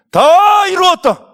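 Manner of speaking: high, drawn-out shout; loud cry. Acted. Jesus on the cross just before death, loud triumphant cry. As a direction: cry out at full voice, stretching the words. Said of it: "크게 소리쳤어요".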